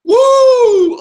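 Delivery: loud, high cheering whoop; excited whoop. Real, not acted. To the impressionist, loud high cheering whoop after his impression. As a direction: let out a big whoop.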